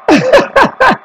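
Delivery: high-pitched burst of laughter; real high laugh. Real, not acted. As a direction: break into a high cackling laugh. Commentator laughs after penalty.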